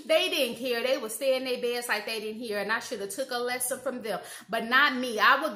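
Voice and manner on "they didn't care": ordinary talk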